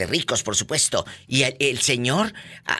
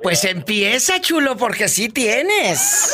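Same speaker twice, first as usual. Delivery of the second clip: much higher and slow through a laugh; teasing laugh; 9 semitones higher, much slower than usual. Real, not acted. Host teases her guest, laughing high and slow. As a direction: tease him, laughing as you say it.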